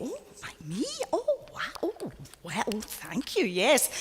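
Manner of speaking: flustered, high and breathless surprise; a hammed-up flattered gasp of thanks; mock flustered surprise. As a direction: gush like someone caught off guard by the invite. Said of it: "and you go".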